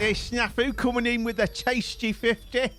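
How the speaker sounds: loud, high, excited announcement; thrilled. Real, not acted. Streamer hailing a friend's surprise gift, thrilled. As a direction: hail the big gift, voice lifted high.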